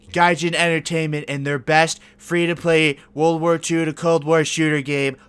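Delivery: lightly voiced Trump bluster; a hint of Trump showmanship; Trump impression. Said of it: "This is Donald J. Trump".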